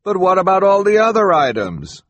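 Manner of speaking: high, loud call from the crowd; a bidder calls out to the auctioneer, loud. A narrator's reading. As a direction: shout the question across the room. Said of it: "someone called".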